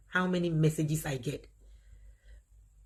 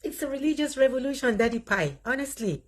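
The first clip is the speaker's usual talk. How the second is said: higher and louder, thrilled declaration; excited exclamation; 8 semitones higher, 4 dB louder than usual. Real, not acted. Declaring to Daddy Pai, a religious revolution, excitedly. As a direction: announce it with rising excitement.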